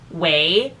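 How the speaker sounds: usual speaking voice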